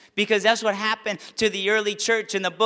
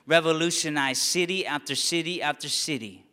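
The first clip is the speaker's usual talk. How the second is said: normal talking voice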